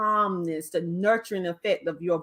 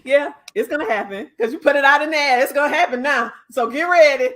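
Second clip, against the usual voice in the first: excited teasing; 7 semitones higher, 6 dB louder than usual. Real, not acted. Teasing excitement about TED talk.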